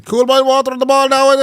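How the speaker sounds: high-pitched excited match commentator; Micheál Murray Hurtic. Acted. Co-host calls the imagined match, commentator voice. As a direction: sing out the play from the commentary box. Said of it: "think of Micheál Murray Hurtic calling out his name".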